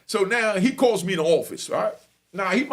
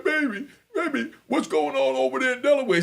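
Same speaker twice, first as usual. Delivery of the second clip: higher, laughing put-on sweet voice; mock sweet husband; 8 semitones higher than usual. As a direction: ham up the doting husband. Counselor greets his wife sweetly.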